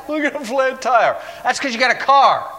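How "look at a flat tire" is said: high-pitched mock grumble. Preacher mimics Christians upset by small troubles, mock whining. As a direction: imitate someone moaning over a small mishap. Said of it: "the way some Christians are".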